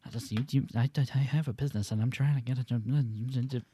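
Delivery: hushed fast offended owner; quietly scolding, sped up; playing restaurant owner. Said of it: "your Italian restaurant's going out of business".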